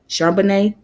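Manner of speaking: "Charbonnet" with a silent final t; tentative pronunciation. Shown as a demonstration. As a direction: try out "Charbonnet" with an "-ay" ending. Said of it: "if I'm saying it right".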